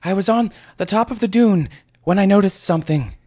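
high, loud, winded report; panting. Acted. Out-of-breath youth reporting to the sorcerer, panting. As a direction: push it out between gasps. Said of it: "he eventually panted".

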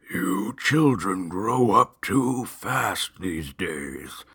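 slow, drawn-out weary monster. A narrator's reading. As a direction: drag each word like a tired ghoul. Weary monster voiced slowly.

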